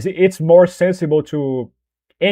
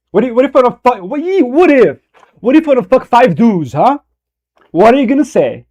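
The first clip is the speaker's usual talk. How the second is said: mock defiance; 8 semitones higher, 5 dB louder than usual. Mock confronting his mom.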